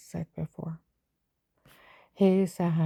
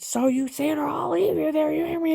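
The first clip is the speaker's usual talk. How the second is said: high, loud threatening yell; mock screaming agent; 10 semitones higher, 5 dB louder than usual. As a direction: shout the threat down at her.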